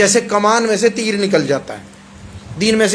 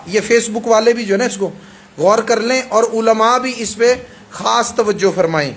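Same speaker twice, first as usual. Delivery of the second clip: ordinary delivery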